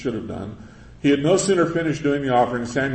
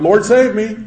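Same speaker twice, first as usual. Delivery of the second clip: crying out; 9 semitones higher, 8 dB louder than usual. Retold or imitated. Sinking Peter cries out.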